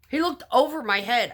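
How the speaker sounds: high and loud, hammering the word looked; outraged disbelief that he missed her completely; loud incredulous. Retold or imitated. Retelling the chase to viewers, loud and incredulous. Incredulous punchline about his height.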